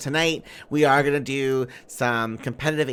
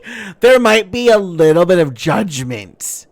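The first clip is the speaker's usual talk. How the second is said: mock offended; 6 semitones higher, 7 dB louder than usual.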